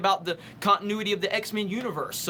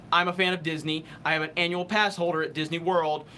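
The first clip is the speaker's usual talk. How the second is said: ordinary talk, nothing marked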